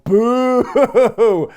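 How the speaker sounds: loud, high mock crowd boo. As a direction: boo like the whole arena. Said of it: "the fans are going".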